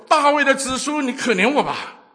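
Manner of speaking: full-force desperate shout. Acted. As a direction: yell it out, begging harder. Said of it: "他越发大声地喊叫说".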